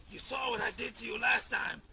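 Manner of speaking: fast furious threat; angry shout. Acted. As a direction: rush it out in anger.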